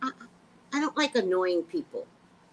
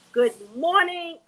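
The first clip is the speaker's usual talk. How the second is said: loud host greeting; 8 semitones higher, 8 dB louder than usual. Shown as a demonstration. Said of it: "not getting the intonation".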